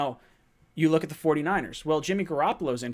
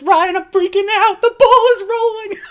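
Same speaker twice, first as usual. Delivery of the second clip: much higher and louder, slow, hammed-up panic; frantic excited reading; 13 dB louder than usual. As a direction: read the super chat as a fan freaking out. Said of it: "He says".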